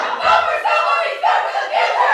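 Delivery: high loud rally chant; shouting. Acted. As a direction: chant it over the crowd. Rival crowd chants.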